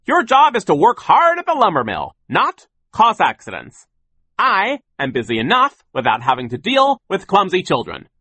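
loud, high, emphatic scolding; blustering boss's angry tirade; yelling. A narrator's reading. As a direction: berate them at full voice. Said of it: "he yelled".

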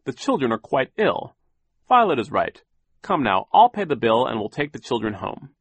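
a hint of a busy banker; Mr Poe. A narrator's reading. Poe deciding to take them home, fussy. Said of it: "Mister Poe said".

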